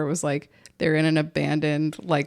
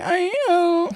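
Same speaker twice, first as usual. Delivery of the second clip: high-pitched weepy wail; mock crying; 12 semitones higher than usual. Playing the crier in the woods, mock crying. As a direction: blubber it in a put-on cry. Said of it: "he's crying".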